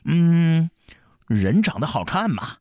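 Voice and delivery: put-on high little-boy voice round a lychee; drawn-out loud hum, then a childish answer; mouth-full mumble. A narrator's reading. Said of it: "嘴里边咕噜咕噜地说". (含着荔枝咕噜地说)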